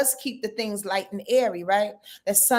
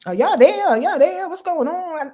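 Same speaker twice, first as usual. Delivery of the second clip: higher, louder repeated "Are y'all there?"; mock anxious asking; 8 semitones higher, 6 dB louder than usual. Host imitating herself asking if viewers are there, mock anxious. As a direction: play the worried host calling out to viewers. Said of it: "please forgive me for asking".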